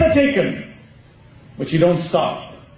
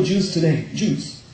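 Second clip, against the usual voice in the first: normal talking voice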